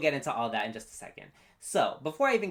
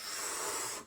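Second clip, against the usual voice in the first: soft voiceless whooshing breath; imitated whoosh; 9 dB quieter than usual. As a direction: blow out a hushed whoosh sound effect. Imitates sound timed to the music.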